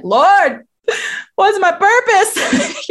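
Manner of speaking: high, slow crying plea, lightly acted; a hint of sobbing as she calls out to God; retold tearful plea. Recalling crying out to God in the bathtub, high and slow. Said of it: "me sobbing in the bathtub".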